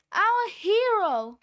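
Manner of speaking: high, loud, slow cheer; shouted cheer. A narrator's reading. The boys cheering their rescuer, shouting. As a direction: shout the praise. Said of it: "the boys shouted".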